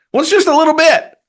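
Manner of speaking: high, loud mock protest. Acting out protest over tiny amount.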